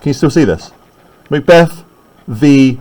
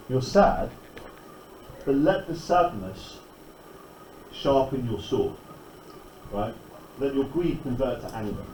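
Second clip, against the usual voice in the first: quiet paraphrase; 2 semitones lower, 18 dB quieter than usual. Retold or imitated. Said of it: "So he says".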